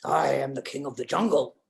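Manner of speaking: low, stately lion voice; put-on lion, pitched well down. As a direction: proclaim it as a deep-voiced jungle king. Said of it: "And the lion says".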